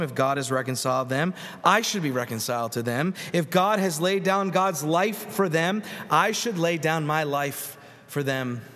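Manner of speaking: ordinary delivery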